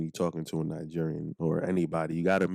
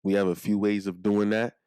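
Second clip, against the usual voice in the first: ordinary talk, nothing marked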